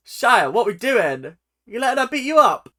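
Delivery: loud, high disbelieving shouts; incredulous yelling. Real, not acted. Yelling at Shia in the video, loud disbelief. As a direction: yell at the screen in disbelief.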